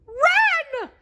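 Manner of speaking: high falsetto shout; high cartoon mouse shouting a warning; Mickey shouting. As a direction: yell it to your friends, very high. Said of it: "Mickey shouted".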